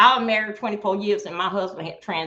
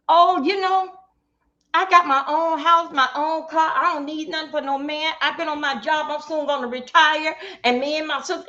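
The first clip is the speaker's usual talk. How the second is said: imitating a boastful woman; 4 semitones higher than usual. Woman telling a man she has her own house and car, bragging. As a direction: brag like she needs no man. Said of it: "a woman tells me".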